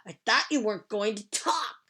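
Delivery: put-on loud, cross boy's voice; boy hollering. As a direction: holler it at her, annoyed. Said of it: "he hollered".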